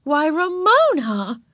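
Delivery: high, drawn-out scandalized exclamation; shocked big sister. A narrator's reading. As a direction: stretch the name in shock. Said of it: "Beezus was shocked".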